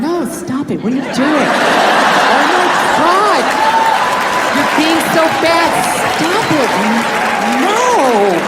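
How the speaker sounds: drawn-out flirty protest; coy, playful no. Acted. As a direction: protest while clearly wanting it, words stretched. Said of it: "that's not a fucking no".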